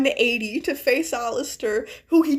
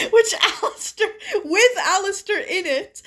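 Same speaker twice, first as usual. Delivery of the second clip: higher, struggling through laughter; laughing while reading; 6 semitones higher than usual. Real, not acted. Reader fumbling the missile line, laughing. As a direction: try to read on while cracking up.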